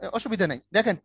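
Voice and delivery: ordinary talk, nothing marked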